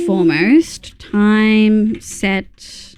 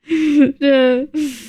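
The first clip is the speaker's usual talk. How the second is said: laugh; 4 semitones higher than usual. Real, not acted. Laughing at chat.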